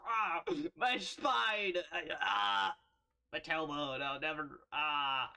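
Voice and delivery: slow, loud hammed-up groans; mock pain groans. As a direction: ham up a sore back. Pretend pain sitting down.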